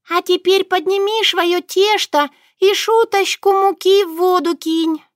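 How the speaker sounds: high, squeaky commanding voice; bossy little mouse. A narrator's reading. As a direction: order her about like a tiny boss. Murinka orders again.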